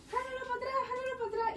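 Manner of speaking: high, strained calls from behind; voices calling behind. Retold or imitated. Cousins calling from behind to pull the reins, high urgent calls. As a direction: echo the cousins shouting advice. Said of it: "yo escuchaba las voces atrás".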